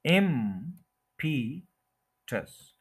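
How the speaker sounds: Impetus very slowly as IMM... pi... tus; impetus broken into three slow pieces; syllable by syllable. Shown as a demonstration. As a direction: hold IM in impetus, pause between syllables. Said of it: "IM पे stress है".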